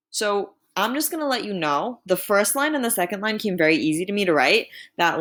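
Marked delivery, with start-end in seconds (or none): none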